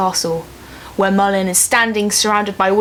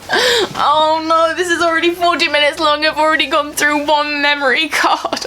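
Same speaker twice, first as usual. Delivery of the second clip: high, tearful lament; whiny dismay; 5 semitones higher than usual. Real, not acted. Vlogger lamenting her overlong video, whiny dismay. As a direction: moan about it in a high, weepy voice. Dismayed at how long recording is.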